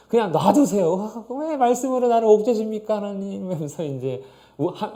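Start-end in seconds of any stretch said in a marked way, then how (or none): 0.9-3.5 whiny complaint. Acted. Believer whining against God.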